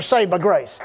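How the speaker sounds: driving sermon delivery with punched key words; emphatic preaching. Real, not acted. Preacher tells congregation of their new identity, emphatic preaching. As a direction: preach it in rising and falling phrases.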